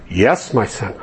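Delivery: low, calm voice of God from above. Acted. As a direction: answer like a patient God. God answers man in joke.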